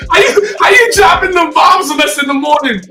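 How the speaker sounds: high, loud laughing disbelief; astonished outburst. Real, not acted. Host reacting to guest's Moscow birth, astonished. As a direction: squeal it out, floored by the news. Host bursts out at surprise news.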